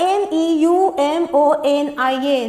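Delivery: slow letter-by-letter reading; spelling out. Shown as a demonstration. Teacher spelling what remains after silent P, spelling out. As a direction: spell slowly, leaving off the silent first letter. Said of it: "जिसमें पी silent हो गया".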